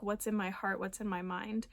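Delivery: ordinary talk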